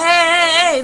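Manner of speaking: high, loud hailing shouts; calling out. Retold or imitated. Dealer shop workers hailing her, calling out. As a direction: imitate men yelling to get someone's attention.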